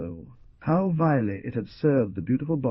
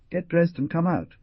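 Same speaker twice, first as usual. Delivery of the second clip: loud, high barked order; a loud, pushy command to get dressed; noisy command; 10 semitones higher, 5 dB louder than usual. Acted. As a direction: order him out noisily. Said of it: "commanded Stepp noisily".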